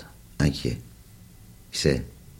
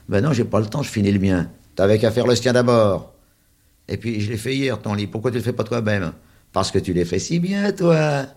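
put-on child bickering voice; 6 semitones higher, 4 dB louder than usual. A narrator's reading. Children bickering over a bed.

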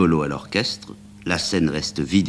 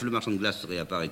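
ordinary delivery

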